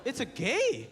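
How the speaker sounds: put-on mom with Chinese accent. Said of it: "she's like".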